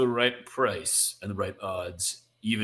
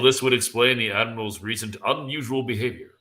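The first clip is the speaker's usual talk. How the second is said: usual speaking voice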